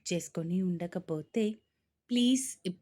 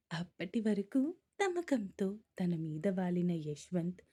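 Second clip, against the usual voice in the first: ordinary delivery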